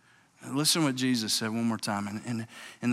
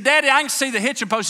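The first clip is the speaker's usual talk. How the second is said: higher and louder, calling across a distance; hollering boy; 12 semitones higher, 7 dB louder, faster than usual. Retold or imitated. Boy in the dark yells to his father: a high holler. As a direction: holler it back toward the house. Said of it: "can you see the hitching post".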